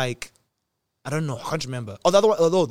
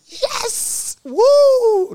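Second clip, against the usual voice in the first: very high, loud whoop of relief; joyful scream; 9 dB louder than usual. Retold or imitated. Patient hearing it is TB not cancer, elated scream. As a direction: scream it out in triumph, holding the whoop long. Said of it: "did I not scream".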